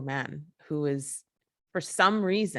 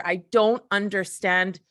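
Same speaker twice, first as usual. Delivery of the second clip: usual speaking voice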